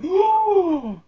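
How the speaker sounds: high, loud mocking ooh; a class of kids going ooh; kids' ooh. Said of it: "all the kids went".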